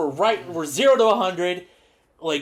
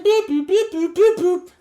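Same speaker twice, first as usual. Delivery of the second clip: high, loud piping boops like a cheap ocarina; mock ocarina notes, very high; ocarina imitation; 13 semitones higher, 5 dB louder than usual. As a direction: toot it out like a toy flute. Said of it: "playing the Song of Time ... on a bootleg ocarina".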